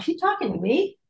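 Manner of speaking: very high disbelieving question; incredulous. Retold or imitated. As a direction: look around, baffled and high. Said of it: "I'm looking around like".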